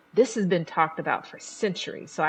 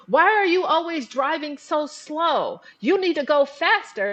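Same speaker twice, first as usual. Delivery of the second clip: higher, louder snapping at the partner; mock angry yell; 9 semitones higher, 4 dB louder than usual. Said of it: "you yell at your partner and say".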